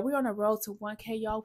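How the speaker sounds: ordinary talk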